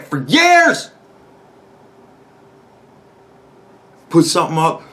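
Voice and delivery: loud, high-pitched exasperated shouting; furious rant. Real, not acted. Vlogger raging about years of pointless arguing, furious shouting. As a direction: yell it at the end of your rope. Ranting about endless online arguments.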